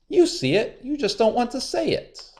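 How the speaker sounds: very high, frustrated pleading; high angry Anansi; angry spider. A narrator's reading. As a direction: trickster losing his temper. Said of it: "Anansi stamped his legs".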